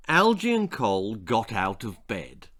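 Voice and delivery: usual speaking voice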